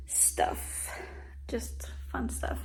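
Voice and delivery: whispered, slow and hushed; breathy whispered aside; soft whisper. Real, not acted. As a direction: drop to a whisper. Trailing off about decor.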